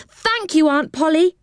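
high, gushing thanks; grateful delight. A narrator's reading. Rick hugging his aunt in thanks, high delighted voice. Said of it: "said Rick, hugging her".

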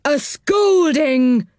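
put-on high, slow old dame voice; Dame Washalot's voice. Dame Washalot echoing the threat, high and slow. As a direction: drag the word out like a cross old washerwoman. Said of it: "said Dame Washalot's voice".

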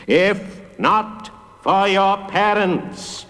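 high, hugely drawn-out chant; crowd calling back. A narrator's reading. Big people answering the judge, calling back slowly. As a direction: stretch every word in a chorus-like reply. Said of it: "shouted back".

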